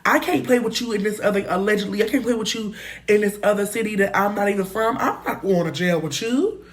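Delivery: ordinary delivery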